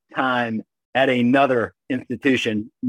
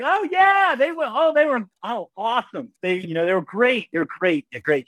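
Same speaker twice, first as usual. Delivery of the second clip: starts much higher and settles down to normal; fake breezy bluff; 11 semitones higher than usual. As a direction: bluff cheerfully, then lose steam. Bluffing to mom about grades.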